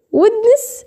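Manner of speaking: "ويدنس" high and questioning, with the d sounded; wrong pronounced D. Shown as a demonstration. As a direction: ask doubtfully "ويدنس", d before n. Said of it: "لا ما بنطقهاش ويدنس".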